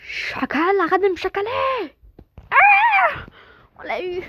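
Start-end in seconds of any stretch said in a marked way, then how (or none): 0.0-2.0 loud, high angry yell; angry shout. Real, not acted. Shouting at enemy in game. 2.5-3.2 short high shriek; sudden scream. Real, not acted. Hit in game fight.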